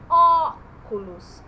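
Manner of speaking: "óculos" with a long open "ó"; loud, slow "óculos", first vowel like "aw"; open O sound. Shown as a demonstration. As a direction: punch and stretch the open "ó" in "óculos". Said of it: "mas com um som diferente".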